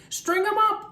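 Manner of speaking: high, loud angry shout; angry mob shout. A narrator's reading. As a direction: demand punishment loudly. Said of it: "the men of the town shouted".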